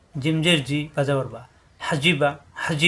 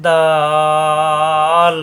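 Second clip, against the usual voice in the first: দাল held very long on a level, louder note; long drawn-out vowel; 5 dB louder, much slower than usual. Shown as a demonstration. Teacher demonstrates letter length, দাল intoned very long. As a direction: stretch the vowel of দাল for four alifs. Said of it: "চার আলিফ পরিমাণ টান হবে".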